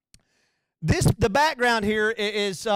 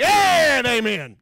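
loud drawn-out amen; 4 semitones higher, 8 dB louder, much slower than usual. Retold or imitated. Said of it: "the loudest one".

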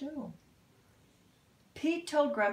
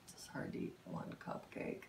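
soft lower whisper; whispered aside; 14 dB quieter, faster than usual. Real, not acted. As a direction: confide it in a whisper.

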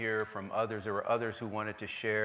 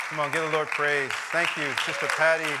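loud, raised voice over applause; rallying the congregation; 6 semitones higher, 7 dB louder than usual. Real, not acted. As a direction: call out praise to the whole church. Pastor rallies the applauding congregation.

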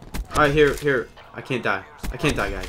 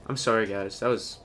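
ordinary talk, nothing marked

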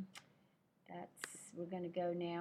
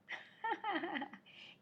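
high laugh; 12 semitones higher, 4 dB quieter than usual. Real, not acted. Laughs after the interruption.

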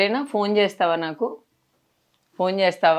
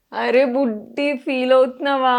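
high, loud emotional cooing; tearful cooing; 6 semitones higher, 5 dB louder, slower than usual. Real, not acted. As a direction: coo in dismay to an upset little one. Consoling crying child before leaving.

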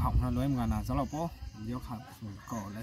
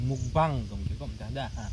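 talking between bites; chewing while laughing; mouth full. Real, not acted. Seen 0: Holds drumstick at mouth, biting it while speaking and laughing.

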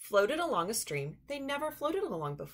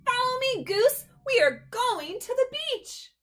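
high, loud, racing ahead; calling back thrilled; excited call; 7 dB louder than usual. Acted. Duck running off calling.

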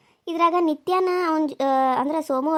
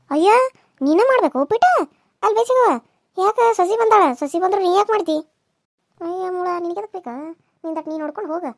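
mock scolding; 4 semitones higher, 4 dB louder than usual. Woman scolds the elder for cooking uppittu, higher then muttering. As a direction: tell her off over the uppittu.

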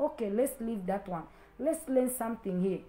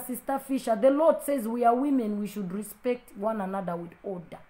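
ordinary delivery

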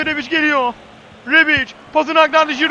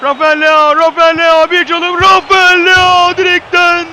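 building to a loud shout; rising excitement into a yell; excited commentator; 3 semitones higher, 7 dB louder, slower than usual. Real, not acted. Attack builds, shot hits post.

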